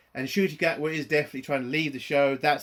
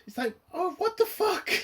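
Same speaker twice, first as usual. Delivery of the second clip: much higher, squeaky exasperation; exasperated squeal. Real, not acted. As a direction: shriek it in disbelief. Ranting about the episode.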